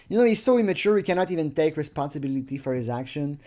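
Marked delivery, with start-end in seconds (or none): none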